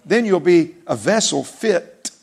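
very slow and deliberate, "purge" lifted; slow scripture emphasis. Real, not acted. Preacher slowly quoting Timothy to born-again Christians. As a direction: lay each word down like a verdict.

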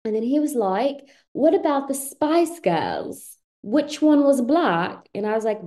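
playful hammed-up Brit; fake British accent. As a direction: act the tale in put-on British. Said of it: "with the British accent".

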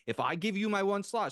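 high-pitched mock-stern booking lady; imitating strong attitude. Booking lady offering her one slot, imitated with attitude. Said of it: "really strong attitude".

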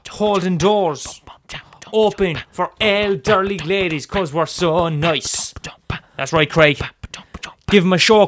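loud showman working a crowd; street performer hype. Acted. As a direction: egg on a beatboxer over the beat. Stage act hyping up beatboxer.

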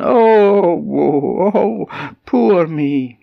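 high, loud, long-held moans; hammed-up self-pitying lament; woeful goat wail. Goat lying on the floor moans, woeful wail.

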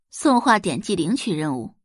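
ordinary delivery

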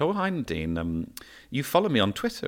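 high, sweetly ingratiating greeting; butter her up in a high sweet voice; sugary approach. Retold or imitated. Telling the story of meeting Nadine, mock sweet approach.

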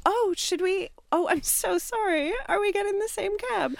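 high, put-on apologetic innocence; faux-innocent stranger at the taxi; feigned surprise. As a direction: pitch it way up, as if it's all an accident. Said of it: "I did the, like".

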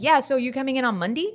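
put-on South Texas accent, "Monday" ending in -dee; dad's South Texas accent. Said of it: "my dad's like".

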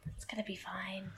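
unvoiced whisper, barely audible. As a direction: breathe 'It's gonna be fine.' as a whisper. Whispered echo of the line.

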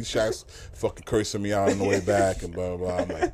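ordinary talk, nothing marked

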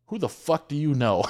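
loud, high-pitched retold outburst; mock outraged demand. Sales manager on speakerphone, imitated incredulous outburst. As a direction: imitate a caller demanding answers in disbelief.

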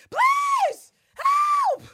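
high, loud desperate scream; screaming for help. Retold or imitated. Eve cradling Villanelle, screaming please help. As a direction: scream for help down the empty street.

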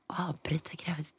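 shocked whisper, no voice at all. Retold or imitated. Turning to Kevin's mother at the doctor's, whispering. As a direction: breathe the question out unvoiced, stunned. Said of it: "skulle viska".

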